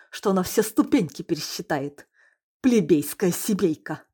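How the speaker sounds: furious. Acted. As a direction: scold like an insulted woman. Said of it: "рассерженная, оскорблённая в лучших чувствах".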